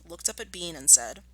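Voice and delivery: plain reading voice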